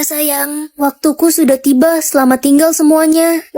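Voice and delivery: ordinary delivery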